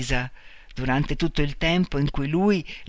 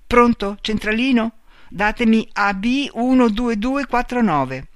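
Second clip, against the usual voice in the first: higher, louder calling voice; urgent phone call; 7 semitones higher, 5 dB louder than usual. A narrator's reading. Marta asks the operator for the number, urgent and raised.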